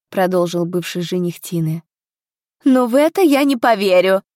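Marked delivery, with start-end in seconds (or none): 2.6-4.2 high, loud outcry of refusal; indignant raised voice; defiant shout. A narrator's reading. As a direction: cry it out, refusing to believe. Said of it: "закричала всё та же женщина".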